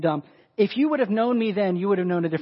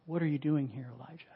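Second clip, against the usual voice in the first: soft, low-key question; hushed divine question; gentle voice of God; 3 semitones lower, 10 dB quieter than usual. Acted. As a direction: ask it quietly and simply. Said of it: "The voice came to him and said".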